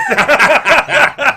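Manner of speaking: big burst of laughter; loud laugh. Real, not acted. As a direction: laugh out hard. Laughs at cart-away joke.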